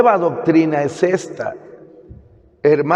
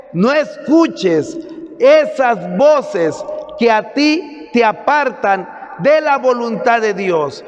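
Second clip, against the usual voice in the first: emphatic sermon in long, insistent phrases; preaching; 5 semitones higher than usual. Acted. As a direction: drive it home to the congregation. Preaches to a congregation.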